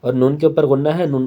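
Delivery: normal talking voice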